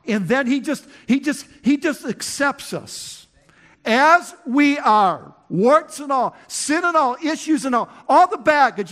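shouting grace from the pulpit; climactic preaching. Acted. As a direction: build the list to a pulpit peak.

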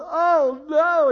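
loud, high "Oh no" of dismay; mock panic. Husband recalling wife's pregnancy news, loud dismayed cry. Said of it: "retelling his panic again".

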